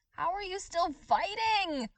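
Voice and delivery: villain screaming in disbelief at his foe; screamed. Acted. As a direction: scream it at the unstoppable hero. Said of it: "Overhaul screamed".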